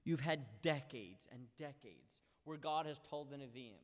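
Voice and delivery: normal talking voice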